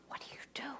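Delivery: a clerk whispering across the counter. Retold or imitated. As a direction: lean in and whisper the question. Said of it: "And he's whispering".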